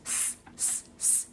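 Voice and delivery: long unvoiced hiss, s s s; hissing s sound. Shown as a demonstration. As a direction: hiss the s like a sizzling sausage. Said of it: "Do that with me".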